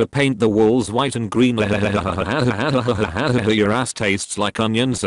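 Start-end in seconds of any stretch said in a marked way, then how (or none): 1.6-3.5 breathy mock villain cackle; hammed-up wicked laugh; evil cackle. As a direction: cackle like a gleeful cartoon villain. Cackling over his own villain boast.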